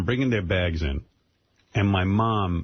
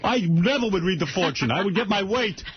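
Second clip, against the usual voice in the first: stern father; 9 semitones higher than usual. Retold or imitated. Daughter imitating her upset father, higher and scolding. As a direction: scold like a fussy old father. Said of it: "he says".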